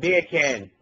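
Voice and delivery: "Beer can" in a British accent, sounding like Jamaican "bacon". Shown as a demonstration. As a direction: try British, land on Jamaican. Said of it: "Say beer can in a British accent".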